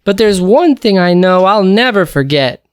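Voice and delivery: high, bright cub voice; a small cub making a proud promise; cheerful little cub. Acted. Pip tells daddy he will get better at remembering, cheerful.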